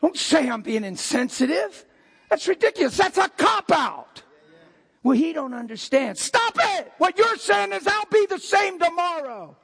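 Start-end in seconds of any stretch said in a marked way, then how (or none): 2.3-9.6 full-force preaching. Acted. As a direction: preach it out to the whole room.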